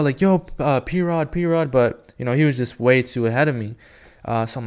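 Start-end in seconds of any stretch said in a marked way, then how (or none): 0.2-1.7 retold calling out. Fan hailing a pro skater ahead, restrained mock yell. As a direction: imitate hailing someone without a full shout. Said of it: "I was trying to yell".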